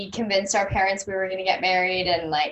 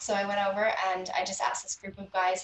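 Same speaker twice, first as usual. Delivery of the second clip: normal talking voice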